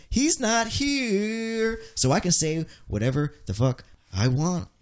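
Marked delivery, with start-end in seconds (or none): 0.1-1.8 slow, high mocking voice. Host mocking absent Elio, slow and high. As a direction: drag it out like a sneer.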